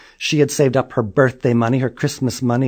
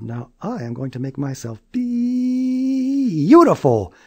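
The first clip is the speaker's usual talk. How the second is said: high, slow excited call; girls yelling; 11 semitones higher, slower than usual. Acted. Neighborhood girls rushing to the bathroom, high slow. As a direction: chant it with glee.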